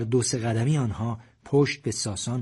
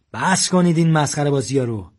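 loud, angry command; armed man scolding the group, loud and angry; 3 semitones higher, 7 dB louder than usual. A narrator's reading. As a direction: snap at them to stop the nonsense.

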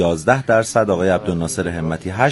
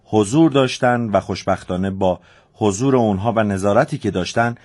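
lifted news-anchor delivery, falling away at the end; news read; 4 semitones higher than usual. Acted. Radio host reading election security news to listeners, anchor tone.

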